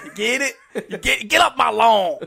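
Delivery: hammed-up Southern holler through laughter; mock Southern accent. Co-host teasing the failed Australian attempt, mocking Southern accent. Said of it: "You went quite southern then".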